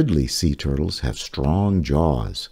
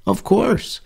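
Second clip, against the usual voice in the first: much higher, as if it were obvious; obvious answer; 14 semitones higher than usual. Acted. Reader answers the book's question, much higher.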